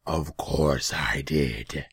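very slow, loud, gloating demon; heavy, slow creature voice. A narrator's reading. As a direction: draw out the confession menacingly. Demon admits it.

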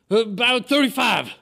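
put-on high, loud old-man holler; worked-up old man. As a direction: call it out as an agitated old villager.

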